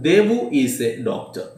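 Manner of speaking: "Devu is a doctor" spaced out word by word; slow dictation. Shown as a demonstration. Teacher dictating an example sentence to students, very slow. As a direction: say it slowly so students can write it.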